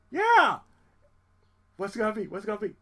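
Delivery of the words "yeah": much higher, louder "Yeah!"; excited cheer. Retold or imitated. Singer cheering at news of a new choir member, excited. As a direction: burst out in hope.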